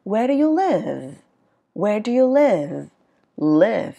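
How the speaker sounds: slow "Where do you live", "live" gliding down; falling question tune. Shown as a demonstration. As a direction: stretch "live" and let it fall. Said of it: "nó không đi lên như vậy". ("live" xuống giọng, kéo dài)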